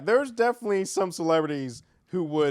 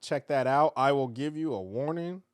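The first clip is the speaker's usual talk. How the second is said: normal talking voice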